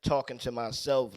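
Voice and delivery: ordinary delivery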